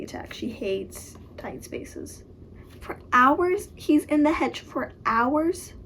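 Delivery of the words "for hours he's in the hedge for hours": higher-pitched, disbelieving question; incredulous. Real, not acted. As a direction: react in real disbelief. Disbelieving reaction to the book.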